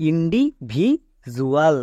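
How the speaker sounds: ইন্ডিভিজুয়াল drawn out very slowly with a j sound; correct pronunciation. Shown as a demonstration. Teacher modelling for students, very slow clear pronunciation. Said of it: "এর উচ্চারণটা কি দাঁড়াবে".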